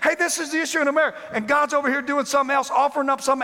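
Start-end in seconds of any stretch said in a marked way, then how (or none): 0.0-1.2 demanding attention. Acted. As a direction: make God look at this. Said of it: "we're like".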